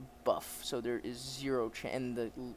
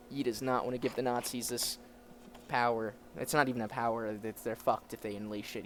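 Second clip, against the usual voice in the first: ordinary delivery, nothing marked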